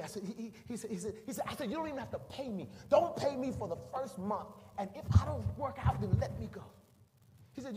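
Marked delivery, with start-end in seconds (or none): 1.7-6.6 earnest, higher-pitched plea; talking someone into hiring you; pleading. Retold or imitated. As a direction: bargain eagerly for the job, voice up. Begging to work without pay.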